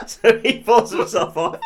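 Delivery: high, loud giggling narration; laughing through it. Real, not acted. Telling his friend the rude bit, laughing hard. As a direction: crack up while telling it. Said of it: "He doesn't pull himself off".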